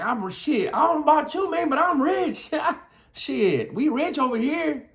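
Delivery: giddy, high-pitched swagger; playful boasting. Shown as a demonstration. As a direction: squeal it with a laugh. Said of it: "it might sound like I'm playing".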